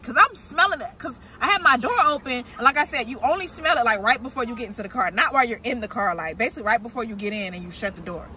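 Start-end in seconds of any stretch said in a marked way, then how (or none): none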